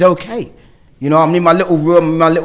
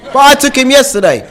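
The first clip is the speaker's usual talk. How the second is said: higher and louder, put-upon; indignant protest; 7 semitones higher, 5 dB louder than usual. Retold or imitated. Retold partner protesting about the school run, indignant. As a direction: object as if hard done by.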